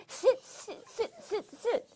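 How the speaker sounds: high, playful "tsit" monkey noises; monkey chatter. A narrator's reading. Storyteller shaking hands with children, monkey chittering. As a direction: chitter sharply like excited monkeys.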